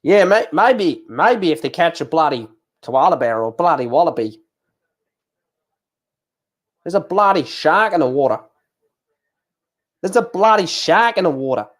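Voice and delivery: exaggerated mock Aussie accent; jokey broad Australian accent. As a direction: ham up the Aussie vowels, swooping pitch. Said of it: "sick to death of my accents".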